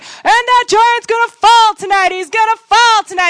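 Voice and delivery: high, loud, slow preacher proclaiming victory; hyped preacher. Retold or imitated. A conference speaker promising the crowd, high loud proclaiming. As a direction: belt it like a revival preacher. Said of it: "the speaker's like".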